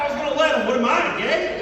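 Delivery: high, loud jeer through a laugh; laughing mock retort. Comic playing a buddy's retort, loud laughing jeer. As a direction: fire back laughing and loud.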